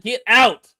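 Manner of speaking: usual speaking voice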